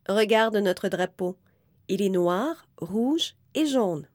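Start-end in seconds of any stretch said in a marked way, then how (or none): none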